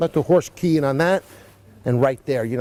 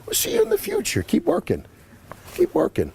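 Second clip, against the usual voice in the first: much higher sing-song voice to the horse; high pet voice; 14 semitones higher than usual. Real, not acted. Rider cheering on the horse, high and sing-song.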